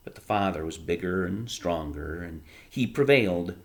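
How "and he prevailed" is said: ordinary delivery, nothing marked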